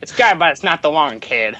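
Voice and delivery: 1920s New York gangster voice, put-on; a tough mobster, hammed-up. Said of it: "New York gangster from the twenties".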